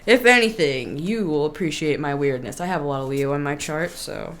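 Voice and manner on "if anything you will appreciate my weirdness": ordinary delivery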